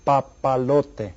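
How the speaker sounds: slow, evenly parted "papalote"; syllable by syllable. Shown as a demonstration. Teacher asks class to count syllables, slow syllable by syllable. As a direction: stretch "papalote" out so each syllable can be counted. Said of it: "how many syllables are there".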